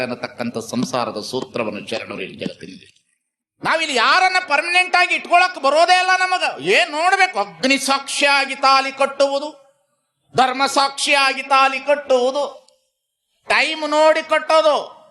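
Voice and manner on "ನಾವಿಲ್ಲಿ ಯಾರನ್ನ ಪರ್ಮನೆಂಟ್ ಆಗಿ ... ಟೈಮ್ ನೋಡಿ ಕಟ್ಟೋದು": high, declaimed sermon; impassioned preaching. Real, not acted. Preacher speaks to the congregation on wedding vows, high impassioned preaching. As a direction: call it out high to the crowd.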